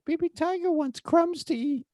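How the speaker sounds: creepy, very high little toy voice; Baby Tiger. Retold or imitated. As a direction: imitate a kid's eerie falsetto for a stuffed tiger. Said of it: "get a little bit creepy where he's like".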